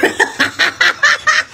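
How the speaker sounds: short high cackle; real amused laughter. Real, not acted. Laughing at viewer reactions.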